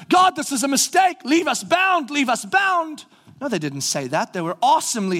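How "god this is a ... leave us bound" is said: higher, hammed-up plea to stay in chains; mock pleading. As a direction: play a whiny slave begging, pitched up. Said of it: "as though the whole time they're going".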